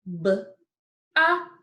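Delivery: b, ah, ks with gaps between for BOX; sound by sound. Shown as a demonstration. Teacher answering with the sounds in box, one by one. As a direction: split BOX into its separate sounds. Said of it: "what sounds did we hear in box".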